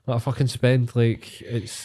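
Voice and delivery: ordinary delivery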